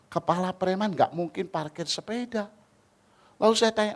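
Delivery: ordinary talk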